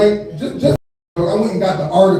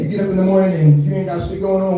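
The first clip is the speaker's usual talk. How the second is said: ordinary talk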